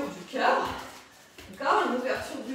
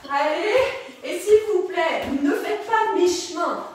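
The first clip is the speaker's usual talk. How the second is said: loud, higher-pitched plea to push; rally the class forcefully; insistent, loud encouragement; exhorting coach; 3 semitones higher, 7 dB louder than usual. Real, not acted.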